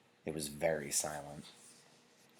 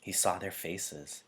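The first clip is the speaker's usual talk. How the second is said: plain reading voice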